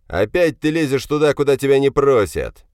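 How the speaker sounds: father's loud, high-pitched telling-off; angry shout. Acted. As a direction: keep shouting him down. Father keeps shouting at son. (сердитый громкий крик отца)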